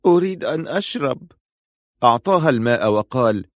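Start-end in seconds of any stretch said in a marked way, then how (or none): 0.1-1.3 put-on high, effortful girl's voice; weak injured girl. A narrator's reading. Injured girl trying to move asks for water, high weak voice. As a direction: plead weakly, pitched well up. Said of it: "وهي تحاول أن تتحرك".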